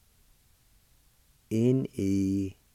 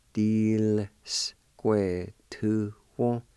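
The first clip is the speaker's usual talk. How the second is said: "Square two one." drawn out very slowly; slow demo; much slower than usual. Shown as a demonstration. Teacher modelling "Square two one." for learners, very slow. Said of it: "pronounce the L sound with the D I like this".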